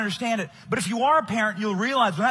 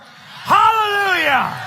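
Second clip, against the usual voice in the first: drawn-out rally cry, louder; hallelujah shout; 7 semitones higher, 6 dB louder, slower than usual. Real, not acted. Rallying the crowd after the Supreme Court, a long loud hallelujah. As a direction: stretch it out like a preacher. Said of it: "Can I get a hallelujah".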